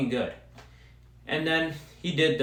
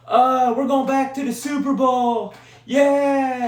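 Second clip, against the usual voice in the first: mock fans; 11 semitones higher, 6 dB louder, slower than usual. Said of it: "Everybody's talking".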